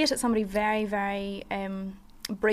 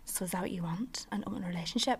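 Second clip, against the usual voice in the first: much softer, whispered quote; mock whisper; 2 semitones lower, 11 dB quieter than usual. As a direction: whisper it like a sly question. Quoting the boyfriend's question.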